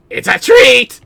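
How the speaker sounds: high, loud joyful yelp; excited shout. Pretend. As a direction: burst out thrilled at the treat. Excited dog about treat.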